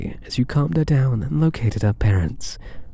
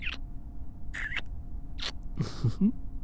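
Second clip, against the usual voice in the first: long faint airy laugh, barely voiced; a hushed smug laugh; breathy soft chuckle; 10 semitones higher, 14 dB quieter than usual. Teasing after "and these.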